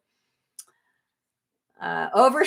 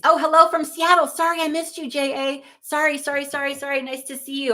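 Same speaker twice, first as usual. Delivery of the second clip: high, excited hello and apology; effusive apology; 6 semitones higher than usual. Real, not acted. Streamer greets a Seattle viewer they missed, effusive apology. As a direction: gush sorry in a high, quick string.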